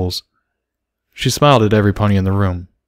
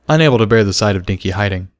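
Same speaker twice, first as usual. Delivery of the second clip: plain reading voice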